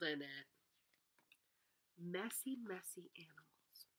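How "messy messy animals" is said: whisper. Shown as a demonstration. Whispered aside while reading.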